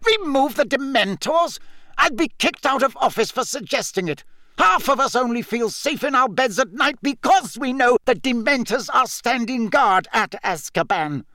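high, incredulous protest; scandalised, indignant argument; outraged shout. A narrator's reading. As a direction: object heatedly, pitch way up. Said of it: "shouted Fudge again".

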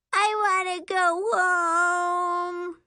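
put-on slow, high whimpering child; whiny little girl. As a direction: whine it like a homesick kid.

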